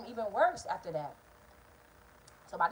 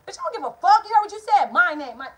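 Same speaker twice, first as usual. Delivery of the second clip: imitating mom screaming, much higher and louder; mother yelling; 9 semitones higher, 11 dB louder than usual. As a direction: go big as the raging mom. Mother voiced in quarrel.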